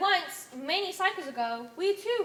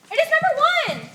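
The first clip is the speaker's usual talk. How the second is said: high, loud startled exclamation; a sudden gasping announcement; shocked cry; 10 semitones higher, 12 dB louder than usual. Acted. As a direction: blurt it out in awe and alarm. Shocked cry at leader's arrival.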